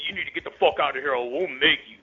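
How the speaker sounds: mock threat. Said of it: "And then, you know, basically".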